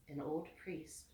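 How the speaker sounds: usual speaking voice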